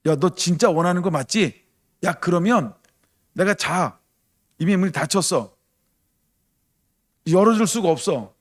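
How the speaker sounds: a sleepy friend brushing off the knocker; reluctant friend. Retold or imitated. Voicing sleeping friend refusing. (문 닫고 누운 친구가 귀찮은 듯 높게)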